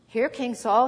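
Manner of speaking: ordinary delivery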